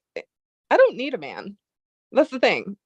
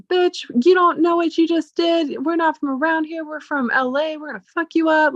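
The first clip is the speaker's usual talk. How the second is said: a hammed-up hint of gang menace; mock menacing threat; 7 semitones higher than usual. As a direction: imitate angry men threatening her. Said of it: "they started going psycho on me".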